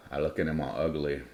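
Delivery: usual speaking voice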